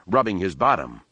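ordinary delivery, nothing marked

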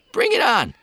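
put-on high, loud nervous young man. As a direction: squeak it out like a startled kid. Post voiced.

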